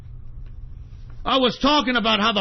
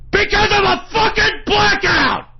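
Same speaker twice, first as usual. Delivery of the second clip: real enraged yelling, high and loud; high, full-force angry scream; furious shout; 12 semitones higher, 7 dB louder than usual. Real, not acted. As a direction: explode with rage on every word. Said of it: "I'm so fucking pissed".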